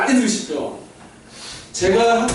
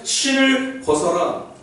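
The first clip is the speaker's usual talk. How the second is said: slow, weighty; 6 semitones higher, much slower than usual. Acted. Slow emphatic God quote.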